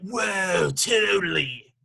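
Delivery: lazy stoner drawl "whoa, totally"; imitating a stoner singer. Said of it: "attitude in his voice".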